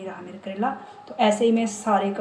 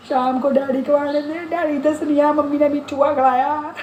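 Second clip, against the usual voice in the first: put-on higher, louder childish tattling yell; imitated child shouting; 6 semitones higher, 6 dB louder than usual. Retold childhood threat to mother, loud childish mock shout. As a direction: shout it like a kid threatening to tell dad. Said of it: "इतनी जोर जोर से चिल्ला रही".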